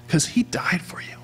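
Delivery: hushed whisper. Real, not acted. Pastor whispering to the congregation about Christ's sacrifice. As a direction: breathe it tenderly.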